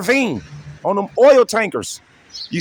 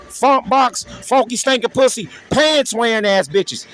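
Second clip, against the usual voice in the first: ordinary talk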